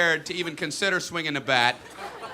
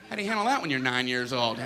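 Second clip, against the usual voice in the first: normal talking voice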